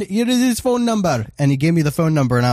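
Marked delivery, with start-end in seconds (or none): 0.0-1.2 mock Indian agent. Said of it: "He was like".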